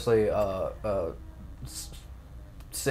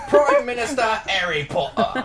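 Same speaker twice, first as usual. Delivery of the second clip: high-pitched mock Cockney; Cockney accent; 7 semitones higher, 3 dB louder than usual. Shown as a demonstration. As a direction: put on a raised Cockney voice. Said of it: "great about a Cockney accent".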